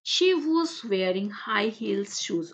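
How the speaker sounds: slow, careful read-aloud for the class. Shown as a demonstration. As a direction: read each word out slowly and clearly. Teacher reads English text aloud.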